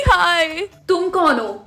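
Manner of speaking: ordinary delivery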